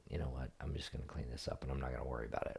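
mild imitation of the son, quietly; lightly voiced son. Son's quote, quiet voice.

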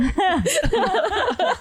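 big burst of laughter; loud high laugh. Real, not acted. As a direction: crack up laughing.

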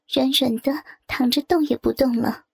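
usual speaking voice

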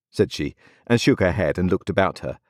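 ordinary delivery

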